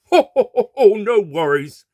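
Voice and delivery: put-on booming good-natured old colonel; jolly colonel. A narrator's reading. Colonel answering the doctor, jolly high laugh. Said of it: "the jolly colonel said".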